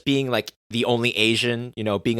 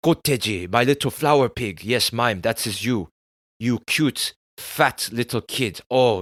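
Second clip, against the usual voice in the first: imitating Korean father. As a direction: put on your Korean immigrant father's accent, doting and scolding at once. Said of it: "like maybe the way that my father used to talk".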